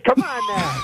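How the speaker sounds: breaking into laughter; laughing. Real, not acted. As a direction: laugh through the words. Laughs mid-protest.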